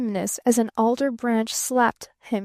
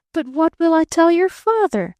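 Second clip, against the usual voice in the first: higher and louder, anxious plea; worried; 6 semitones higher, 6 dB louder than usual. A narrator's reading. Barton frets about her father, worried and louder. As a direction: worry out loud to her. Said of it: "worried Barton".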